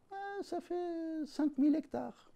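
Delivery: high, slow hesitant voice; small man squeezed between Texans, timid; timid little man. Acted. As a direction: answer shyly, stalling.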